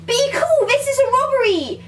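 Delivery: high, loud hold-up threat; mock robbery shout. Comedian acting out film diner robbery, high shouted threat. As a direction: yell it like an excitable amateur robber.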